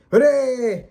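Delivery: mock celebration, dripping sarcasm; sarcastic cheer. Real, not acted. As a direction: cheer it flatly like it's bad news. Sarcastic cheer about bloated games.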